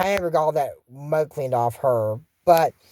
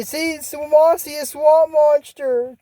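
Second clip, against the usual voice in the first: spooked alert; 8 semitones higher, slower than usual. Acted. Girls spotting the monster.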